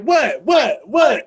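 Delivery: loud, punchy shouts from behind; mock-aggressive hollering; hype-man yell. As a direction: egg them on like backup in a fight. Acts out hyping ally from behind.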